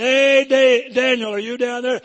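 high, loud call into the den; king calling down. Retold or imitated. The old king calls down to Daniel in the lions' den, loud and high. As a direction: call down into the pit. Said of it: "the old king looked down and said".

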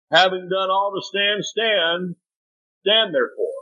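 high, ringing verse read aloud; emphatic scripture reading. Acted. Preacher to congregation, ringing scripture proclamation.

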